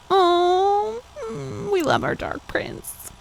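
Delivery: high, slow fangirl coo; swooning. Real, not acted. Fan swooning over Draco, high and slow. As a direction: melt over the character.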